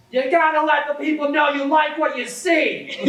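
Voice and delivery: very high, squeaky stranger yelling; shrill mock yell. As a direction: screech it like an unhinged street guy. Stranger yells at narrator.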